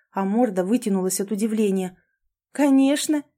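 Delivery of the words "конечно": high, emphatic yes; eager assent. A narrator's reading. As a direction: agree gladly. Delighted answer to the dog. (радостно соглашаясь)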